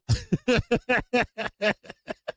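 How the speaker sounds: bursts into high laughter. Real, not acted. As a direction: laugh it off, amused. Laugh alone.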